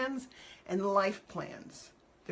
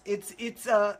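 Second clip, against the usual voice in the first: usual speaking voice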